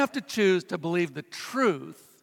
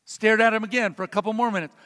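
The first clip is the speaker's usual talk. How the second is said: ordinary talk, nothing marked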